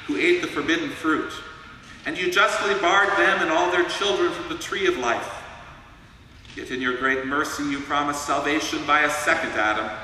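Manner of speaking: ordinary talk, nothing marked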